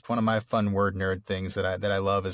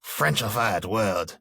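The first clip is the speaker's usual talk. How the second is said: put-on slow, drawn-out French accent; mock French accent; much slower than usual. Co-host sneers at the silly word, mock French accent. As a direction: exaggerate a French accent mockingly.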